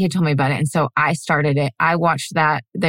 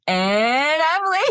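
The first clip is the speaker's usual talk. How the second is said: high, slow hammed-up host voice; game show host; 9 semitones higher, slower than usual. Shown as a demonstration. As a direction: ham up a game show intro. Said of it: "like a game show host".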